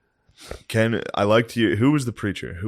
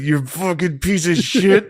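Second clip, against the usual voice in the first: high, slow hammed-up insult; mock roast insult; 9 semitones higher, 3 dB louder, slower than usual. Roaster pretending to insult a friend, mock angry. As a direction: sneer it out in a silly mock-angry voice. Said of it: "I'm just gonna be like".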